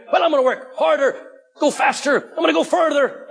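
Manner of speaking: high-pitched, over-eager pledge; hammed-up religious enthusiasm; mock zeal. Mimicking an over-eager striving believer.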